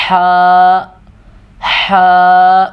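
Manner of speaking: ح pushed from the back of the throat, drawn; ہا drawn long and throaty. Shown as a demonstration. Teacher repeating the throat letter ح for students. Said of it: "تو یہ جو ہے یہ ہے".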